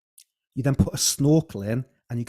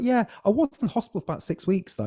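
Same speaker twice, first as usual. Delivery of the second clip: much higher voice, breezy and unbothered; mum's breezy reply; 10 semitones higher than usual. Retold or imitated. Guest voicing his mum on the phone about his birth, breezy and higher. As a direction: toss it off lightly like a mum brushing it aside. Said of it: "She's like".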